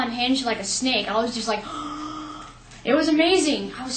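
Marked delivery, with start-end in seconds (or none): 1.7-2.4 long, soft acted gasp; awestruck gasp. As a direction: gasp as if your jaw just dropped. Acting out her jaw dropping.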